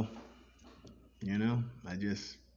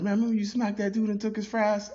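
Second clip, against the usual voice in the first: mocking friend voice; 9 dB louder, faster than usual. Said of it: "you're probably like".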